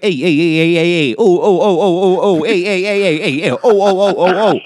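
put-on rapid-fire Italian accent signal; Italian-accented Morse code. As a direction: tap out Morse in mock Italian exclamations. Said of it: "it's got an Italian accent".

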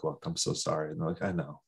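quiet, contrite retelling; subdued apology. Retold or imitated. Acts out couple making up.

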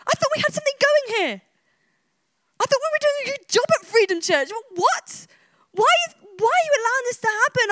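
high-pitched, rushed disbelief; imagined angry complaint to God; indignant protest. Pretend. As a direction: fire incredulous questions up at God. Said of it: "I'd be very tempted to be angry. I'm like".

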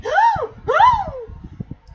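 loud, high mock gasps; big hammed-up gasp; exaggerated gasp. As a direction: gasp dramatically, imitating her shock. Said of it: "she keeps gasping".

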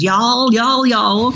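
mock Southern accent on the y'all. Imitating a Southern YouTube teen, light Southern accent. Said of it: "she had this Southern accent".

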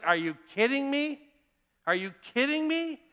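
high-pitched, indignant disbelief; incredulous. Acted. Joseph's brothers reacting to his second dream, incredulous. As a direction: voice jumps up, mock outrage at the nerve of it.

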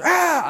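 put-on high-pitched baby protest cry; imitated one-year-old's yell; wordless toddler squawk. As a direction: squawk like a baby claiming a toy. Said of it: "Zoe can't speak yet, so she just goes".

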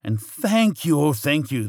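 high, gushing witch thanks; loud, high-pitched gratitude; grateful cry. A narrator's reading. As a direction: cry out thanks with great relief. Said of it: "the grateful witch cried".

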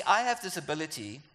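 ordinary delivery

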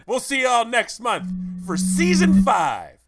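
slow, loud salesman pitch; used car salesman voice. Acted. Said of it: "that used car salesman voice".

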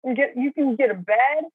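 a hammed-up revelation; mock pep talk. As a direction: talk yourself into it. Imagined self-talk, mock advice.

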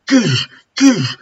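high imitated smashing thuds; sledgehammer smash sound. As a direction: voice each crushing blow as a noise. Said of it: "like someone's got a fucking sledgehammer".

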